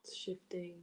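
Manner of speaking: very soft whispered aside; just breath, barely there. Real, not acted. Healer whispering as the energy moves, a very soft whisper.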